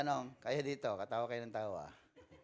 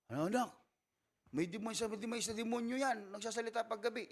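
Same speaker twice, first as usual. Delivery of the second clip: higher, frantic parent warning of a demon; alarmed parent voice; 9 semitones higher than usual. Retold or imitated. Parent warning a child about a demonic toy, high and alarmed. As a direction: pitch up and panic like a worried parent.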